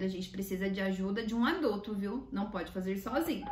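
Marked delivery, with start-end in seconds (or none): none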